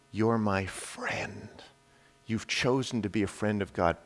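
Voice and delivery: soft, low, unhurried assurance; tender voice of God. Acted. God telling the preacher he is a friend, tender hush. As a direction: say it gently, as to a dear friend.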